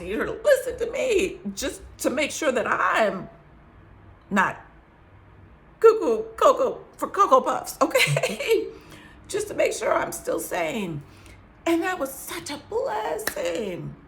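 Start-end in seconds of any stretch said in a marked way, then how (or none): 5.8-8.8 words breaking into laughter, louder; laughing. Real, not acted. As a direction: laugh through the joke. Joking about seeming crazy.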